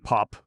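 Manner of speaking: high loud pop sound; imitated cork pop. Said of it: "said the champagne".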